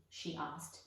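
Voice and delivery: usual speaking voice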